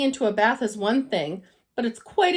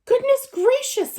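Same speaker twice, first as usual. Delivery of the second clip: shocked exclamation, pitched much higher and louder; exclaiming; 14 semitones higher, 6 dB louder than usual. Acted. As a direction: gasp it out in surprise. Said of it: "exclaimed Missus Bird".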